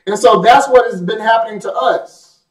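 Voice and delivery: ordinary talk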